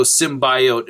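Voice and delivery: "symbiote" as sim-BY-oat; bio pronunciation. Shown as a demonstration. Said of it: "It's bio, right?".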